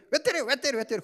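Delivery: put-on high, loud childish protest; imitating a whining child. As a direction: sound like a kid talking back to a parent. Child protesting being hit. (아이가 부모에게 대들 듯 높고 크게 징징대는 말투)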